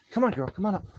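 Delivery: high, sing-song coaxing of a dog; calling the dog up sweetly. Retold or imitated. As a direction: coax the dog up onto the bed. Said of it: "I'm like".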